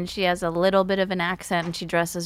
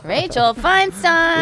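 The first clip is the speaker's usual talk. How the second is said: high, loud, stretched-out name call; excited announcing; 9 semitones higher, 5 dB louder, much slower than usual. Real, not acted. Host welcoming the guest who just arrived, in a high excited call. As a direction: herald the guest's entrance, drawing out the name.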